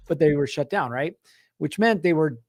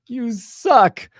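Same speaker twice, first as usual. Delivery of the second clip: higher, louder half-joking jab; mock insult; 7 semitones higher, 5 dB louder than usual. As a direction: toss it off like a sore loser. Frustrated at closed gate.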